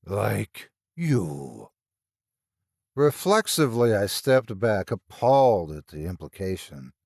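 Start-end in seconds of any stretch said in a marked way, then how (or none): 0.0-1.7 slow rasping monster; a lightly voiced wheezing thing; creature voice. A narrator's reading. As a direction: drag out a sly accusation. Monster speaks in story.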